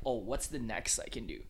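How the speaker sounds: ordinary talk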